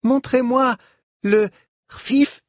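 put-on high eager child, slightly breathless; loud-ish, high little voice, a bit winded; out of breath. A narrator's reading. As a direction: demand it like an excited child who ran up. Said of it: "lança-t-elle hors d'haleine".

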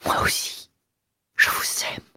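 breathless quick whispered confession; stammered whisper. A narrator's reading. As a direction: blurt it out in a whisper. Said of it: "elle balbutia très vite".

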